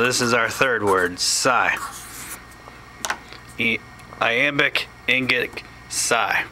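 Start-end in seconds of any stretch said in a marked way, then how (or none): none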